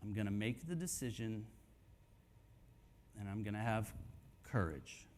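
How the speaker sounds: low, soft, measured decision; quiet resolve. Acted. David at his pivotal moment deciding to have courage, quietly resolute. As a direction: say it low to yourself, pausing before the last word.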